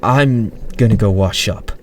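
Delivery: mildly weary, with a smile; understated fatigue; tired. A narrator's reading. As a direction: say it tiredly but kindly. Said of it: "tiredly, smiling".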